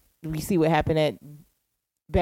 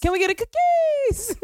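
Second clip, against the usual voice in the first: squealed high. Pretend.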